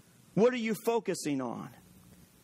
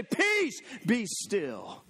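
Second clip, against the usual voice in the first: commanding rebuke; much slower than usual. Shown as a demonstration. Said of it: "and say".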